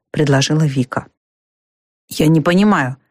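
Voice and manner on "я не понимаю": ordinary delivery, nothing marked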